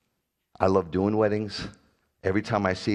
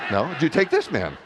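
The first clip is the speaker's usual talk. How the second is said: mock officiant; 5 semitones higher, 4 dB louder than usual. Mock officiant asking next groomsman.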